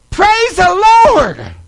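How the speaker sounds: very high, loud, drawn-out praise; overjoyed cry of praise; jubilant shout. Retold or imitated. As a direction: shout thanks to heaven, stretching each word. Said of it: "and shouted out".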